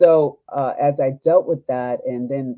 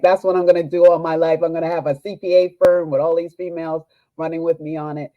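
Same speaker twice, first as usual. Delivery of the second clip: gushing plans; 4 semitones higher, 7 dB louder than usual. Retold or imitated. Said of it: "I was like".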